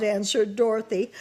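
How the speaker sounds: ordinary delivery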